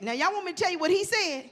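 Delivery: normal talking voice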